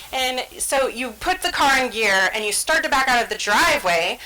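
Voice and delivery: ordinary talk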